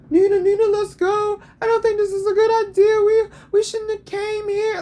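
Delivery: high, loud mocking whine of a nervous woman; mock her pleading in a high whiny voice; imitating a scared, begging sister.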